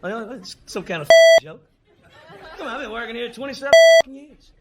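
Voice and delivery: loud, stunned protest; shocked outburst. Retold or imitated. Fired worker yells at his boss, shocked and loud. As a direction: blurt it out in disbelief.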